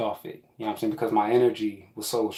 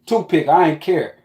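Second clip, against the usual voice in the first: ordinary talk, nothing marked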